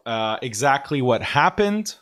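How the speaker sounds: usual speaking voice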